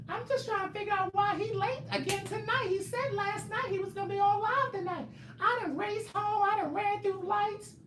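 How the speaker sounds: very high squeaky complaining voice; mocking a fan's voice. Mocking Bianca rushing home for the live, squeaky high whine. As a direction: whine like an impatient fan. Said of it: "that's how I imagine y'all sounding".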